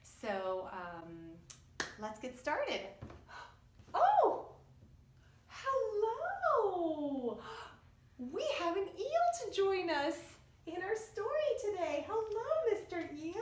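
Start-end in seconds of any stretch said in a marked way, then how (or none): 2.9-3.5 sharp breathy gasp of surprise; surprised gasp. Pretend. Teacher acts surprised by eel puppet. 3.9-13.4 much higher and louder, delighted; excited greeting. Pretend. Greets the eel puppet excitedly.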